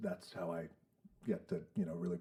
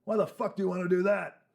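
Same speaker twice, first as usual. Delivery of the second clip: loud, high disbelief; incredulous shout; 11 semitones higher, 10 dB louder than usual. Retold or imitated. Wrestling boss mocking a hopeful rookie, loud disbelief. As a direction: yell it in disbelief.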